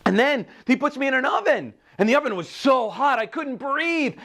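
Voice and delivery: high, distressed narration; panicked telling. A narrator's reading. Teacup put in the hot oven, panicked high telling. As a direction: recount it as though overwhelmed, pitched high.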